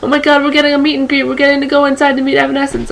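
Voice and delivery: high, fast, breathless excitement; panicked emergency call. Retold or imitated. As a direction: gush it out in a frantic rush. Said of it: "Frantic phone call, freaking out".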